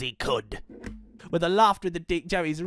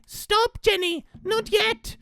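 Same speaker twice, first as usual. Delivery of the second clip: called out; slower than usual. Acted. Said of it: "called Frederick".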